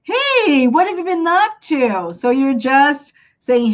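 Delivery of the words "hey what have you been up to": high, lively greeting question; upbeat, linked conversational hello; casual greeting. Acted. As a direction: greet an arriving friend, pitch lifted. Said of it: "when someone comes to you".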